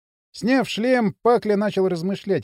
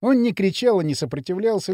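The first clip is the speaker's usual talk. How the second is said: ordinary delivery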